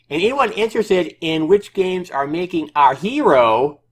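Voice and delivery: high, loud mock developer voice; developer's voice. Reading the developer's odd sentence in a high mock voice. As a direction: read it high and exaggerated, close to laughing. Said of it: "try to read this in his, in his voice".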